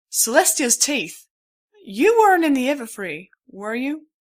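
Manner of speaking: a stallion horrified the stranger came through the Everfree; shocked stallion. A narrator's reading. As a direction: gasp it out in alarm.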